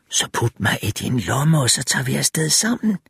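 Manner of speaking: put-on woman's voice, rising at the end; lightly voiced enticing female figure; coaxing female tempter. A narrator's reading. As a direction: coax him to take you along.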